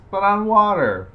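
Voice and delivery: loud, high, emphatic reveal; booming punchline. Acted. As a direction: belt it out as the big twist. Loud punchline while reading letter.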